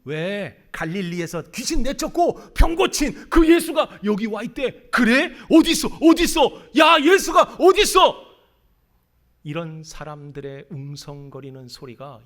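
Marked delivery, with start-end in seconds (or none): 0.0-8.2 louder, higher, eager villagers asking; excited crowd voices. Acted. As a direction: call out like a stirred-up crowd. Crowd voices murmuring about Jesus. (흥분한 군중 목소리)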